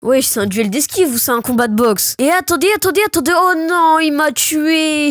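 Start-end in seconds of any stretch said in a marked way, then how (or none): 2.2-5.1 panic, then draw out the defeat; dismayed defeat. Real, not acted. Gets killed in the match.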